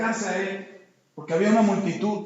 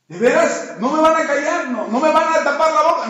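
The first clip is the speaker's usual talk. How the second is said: raised, loud suspicious questioning; wary disbelief; 6 semitones higher, 7 dB louder than usual. Acted. Bartimeo questions the messengers from Jesús, loudly disbelieving. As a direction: question them loudly, not yet trusting. Bartimeo doubts those sent to him.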